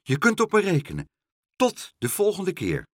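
high, loud, lively parting call. A narrator's reading. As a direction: call it back from the bank. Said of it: "riep Pluk".

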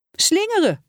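put-on high child's shout of a guess; called out. A narrator's reading. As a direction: call out an answer eagerly, pitched high. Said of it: "riep Lieke".

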